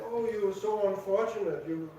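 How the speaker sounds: normal talking voice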